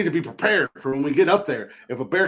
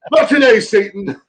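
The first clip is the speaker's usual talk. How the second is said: mock defiant shout; 7 semitones higher, 5 dB louder than usual.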